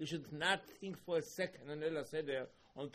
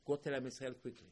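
normal talking voice

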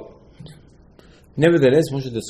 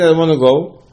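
sulky voiced people; 4 semitones higher, 7 dB louder, faster than usual. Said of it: "I said".

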